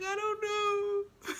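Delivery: high, slow mock wail; imitated crying. Girlfriend answers why she is crying, mock wailing. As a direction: draw it out in a hammed-up sob. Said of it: "So why you crying".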